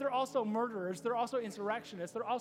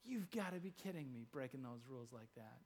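very soft, lowered aside; hushed disbelief; 10 semitones lower, 13 dB quieter than usual. Retold or imitated. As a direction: say it under your breath, incredulous. Acted shocked onlooker, under breath.

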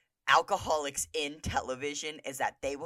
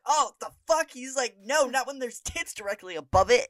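disgusted outburst; 8 semitones higher, 5 dB louder than usual. Retold or imitated.

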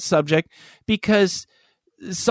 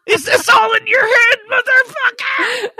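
much higher and louder, a laughing shouted snarl; mock screaming rage; 4 dB louder than usual. Imitating his girlfriend's furious comeback to a dumb remark, laughing shout. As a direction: scream it back half laughing.

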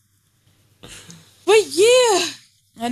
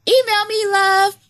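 high, louder flirty call; 12 semitones higher, 3 dB louder than usual. Pretend. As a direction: sweet-talk the customer. Flirty ad sign-off.

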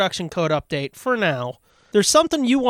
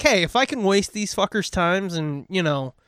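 ordinary talk

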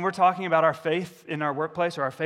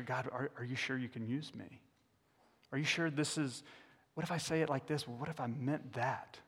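soft, hushed worried questions; anxious doubting prayer; 8 dB quieter than usual. Retold or imitated. Preacher voicing his own fears to God, hushed. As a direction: voice the nervous believer quietly. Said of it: "This is me just being vulnerable".

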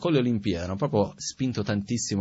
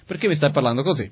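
louder, higher accusing question; angry challenge; 5 semitones higher, 6 dB louder than usual. Retold or imitated. Arguing person snapping back, louder and higher. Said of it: "parlare nello stesso modo".

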